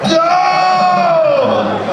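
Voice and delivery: loud high no, hammed-up panic; mock horror shout. Realizing the coworker found her channel, loud mock shout. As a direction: scream it like your secret is out.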